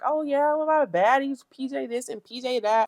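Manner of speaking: a touch of high mocking valley voice; mocking Rolly. Recapping Rolly bragging on the jet, mildly mocking. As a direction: lightly mimic her bragging. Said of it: "She's talking, and she's like".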